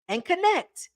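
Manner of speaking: "connect" lifted high, stressed again. Shown as a demonstration. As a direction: repeat it up high to drive it home.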